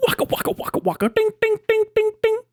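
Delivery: whispered waka then high dinging; Looney Tunes bell dings; cartoon sound effects. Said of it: "go Looney Tunes".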